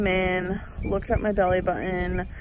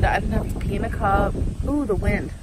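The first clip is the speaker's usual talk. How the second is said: ordinary talk, nothing marked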